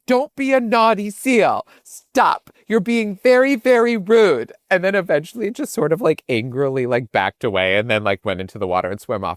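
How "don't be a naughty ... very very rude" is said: high, slow scolding voice; scolding a seal. Retold or imitated. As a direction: tell off a naughty seal like a teacher. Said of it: "chastising it".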